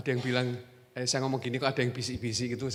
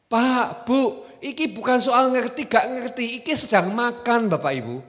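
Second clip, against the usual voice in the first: raised, higher voice chiding the congregation; loud scolding; 10 semitones higher, 8 dB louder than usual. Acted. Preacher calls out churchgoers snacking outside, loud chiding. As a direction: call them out sharply.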